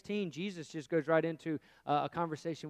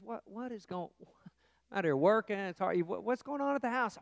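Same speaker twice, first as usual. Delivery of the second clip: put-on exasperated older brother; 5 semitones higher, 3 dB louder than usual. Older brother questions servant.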